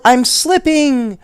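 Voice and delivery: high, loud frightened squeal; panicked doll slipping off. A narrator's reading. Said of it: "she squealed".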